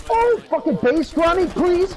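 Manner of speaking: high loud pleading yell; desperate shout. Real, not acted. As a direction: beg loudly in excitement. Pleading during game clutch.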